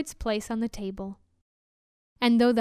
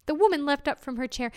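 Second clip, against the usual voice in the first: usual speaking voice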